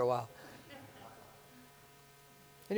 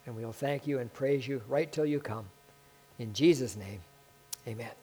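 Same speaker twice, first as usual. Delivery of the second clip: closing prayer. Acted.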